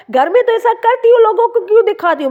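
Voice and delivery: playful mimic of a scolding husband; mock husband scolding. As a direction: tease like a husband chiding his wife for showing off. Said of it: "वो कह रहा था".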